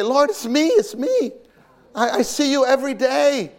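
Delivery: high, anxious "Lord, it's me"; pleading believer. Acted. Believer at judgment begging the Lord to know him, high plea.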